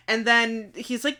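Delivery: ordinary talk, nothing marked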